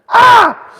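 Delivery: high shrieking goat scream. Retold or imitated. Picturing the screaming goat in her intro, goat scream. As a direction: let out a piercing goat shriek. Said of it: "this goat going".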